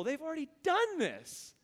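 high-pitched, incredulous insistence; worked-up disbelief, pitched well up; exasperated. Acted. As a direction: protest that it should be obvious. Preacher voices exasperation at rebellious people.

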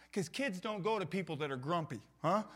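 ordinary talk

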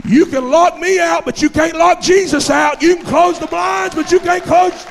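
shouted high, triumphant preacher's cry; full-force preaching. Real, not acted. Preacher defies those who shut the door on prayer, shouting at full force. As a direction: belt it out to rouse the whole church.

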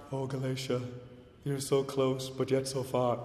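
soft, wistful sigh of regret; mildly sorrowful lament; wrong gentle reading. Pretend. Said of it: "Not like that".

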